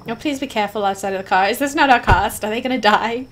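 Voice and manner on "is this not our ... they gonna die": ordinary delivery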